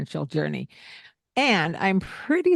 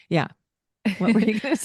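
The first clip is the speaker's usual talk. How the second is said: ordinary delivery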